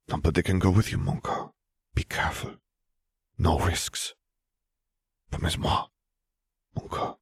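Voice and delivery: a hint of a French lover, hushed and pleading; lightly voiced Fleur, tender. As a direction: softly ask him to promise, lightly French.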